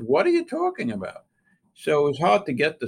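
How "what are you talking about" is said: much higher, baffled what-are-you-talking-about; stunned retold question; incredulous. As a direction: ask it in utter disbelief, pitch shooting up. Baffled reply to uncle's call.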